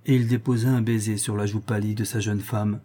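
ordinary delivery, nothing marked

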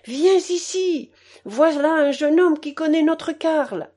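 loud, high, excited calling; father calling his wife in with eager news; slow first words, then eager and loud; excited shout. A narrator's reading. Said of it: "cria mon père".

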